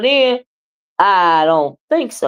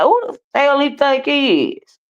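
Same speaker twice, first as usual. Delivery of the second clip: higher-pitched offended question; indignant; 7 semitones higher than usual. Retold or imitated. Woman tells a viewer how she reacted to him, indignant. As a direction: retell an incredulous, affronted reaction.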